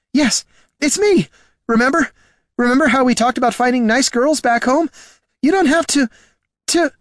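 panicked plea, faltering at the end; desperate pleading. A narrator's reading. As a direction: beg your friend to remember you. Pleads with his undead friend.

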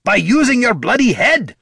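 high, loud angry retort; snapped. A narrator's reading. Dwarf berating the bard for carelessness, snapped. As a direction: snap it back hotly. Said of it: "snapped Gorm".

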